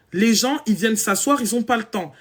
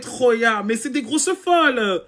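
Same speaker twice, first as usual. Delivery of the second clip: usual speaking voice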